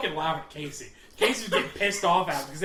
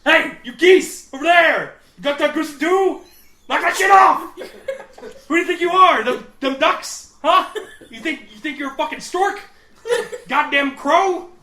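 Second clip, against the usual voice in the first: loud, higher yell at the geese; laughing while shouting insults; 8 semitones higher, 11 dB louder than usual. Retold or imitated. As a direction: berate the birds from the boat. Retold yelling at geese.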